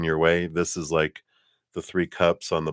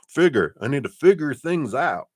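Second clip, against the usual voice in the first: higher-pitched, put-on Southern twang; Southern accent; 8 semitones higher, 4 dB louder than usual. Tarot reader voicing someone needing answers, Southern accent. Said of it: "I don't know why I went Southern accent".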